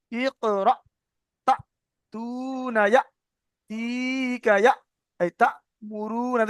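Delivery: syllable by syllable, jolt on "তা"; short glottal catch in "ইকরা"; jolted recitation examples. Shown as a demonstration. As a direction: catch the stops in "ইকরা" and "তা" abruptly. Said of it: "ওই রকম ঝাটকা হবে".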